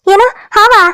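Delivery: high-pitched startled question; alarmed double-take; startled mishearing. Acted. Mishears news as a snake.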